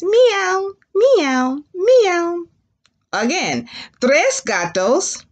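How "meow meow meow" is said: three high imitated meows; high-pitched kitty cries; cat meows. Shown as a demonstration. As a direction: meow three times like a cat. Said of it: "Say it three times".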